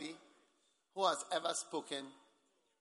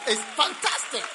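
usual speaking voice

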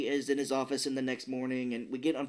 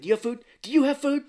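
high, loud mock desperation, building; frantic running man; 12 semitones higher, 9 dB louder, faster than usual. Host acting the man running round hunting food, frantic. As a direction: demand it urgently, louder the second time. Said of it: "And he's, like, running".

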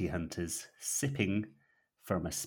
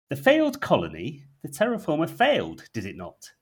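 higher, lightly intrigued questions; a hint of leaning in; intrigued operative; 7 semitones higher, 7 dB louder than usual. Operative probing the scholar, mild intrigue. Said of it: "The operative is intrigued".

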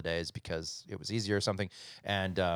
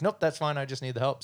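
higher, louder imitated reply; brisk dismissal; 7 semitones higher, 6 dB louder than usual. Ex-wife needing help waves off girlfriend's presence, brisk. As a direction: wave the worry away in a raised voice. Acts out ex-wife's quick answer.

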